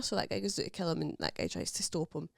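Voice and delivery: ordinary talk, nothing marked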